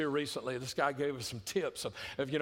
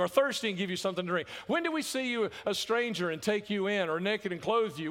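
loud, higher, quick proclaiming of scripture; forceful scripture reading; 6 semitones higher, 4 dB louder than usual. Acted. Preacher reading Jesus' words on the righteous aloud, proclaiming. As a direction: proclaim the verses to the hall.

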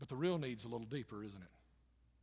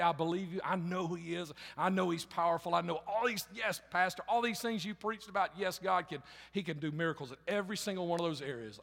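fervent preaching; 4 semitones higher, 6 dB louder than usual. Acted. Pastor voices believer's easy faith.

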